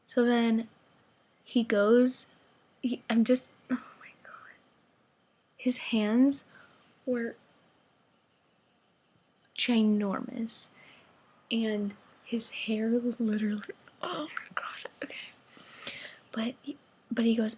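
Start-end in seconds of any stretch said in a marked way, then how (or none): 3.7-4.5 soft, voiceless swoon; overcome whisper. Real, not acted. Fan breaking off her story, overcome whisper. 14.0-15.2 soft gasped-out whisper; swooning whisper. Real, not acted. Fan remembering his hair, swooning whisper.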